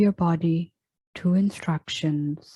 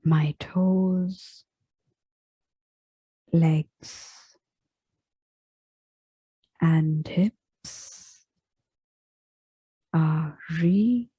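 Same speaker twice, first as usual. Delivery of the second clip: very slow meditation voice with long pauses; unhurried body scan, each phrase left to settle; guided relaxation; slower than usual. Real, not acted. Yoga teacher guiding listeners into final relaxation, slow meditative delivery.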